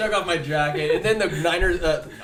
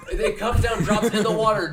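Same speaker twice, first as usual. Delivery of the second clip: ordinary talk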